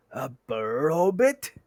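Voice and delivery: slow, puzzled rising query; startled question. Acted. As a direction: trolls baffled by the odd word. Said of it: "a bit startled".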